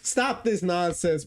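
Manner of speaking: ordinary talk, nothing marked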